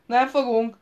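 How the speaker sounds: loud, high shouted retort; shouting down. A narrator's reading. Royce calls down to the king, startling him, shouting. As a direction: shout the denial down from above. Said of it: "kiáltott le Royce".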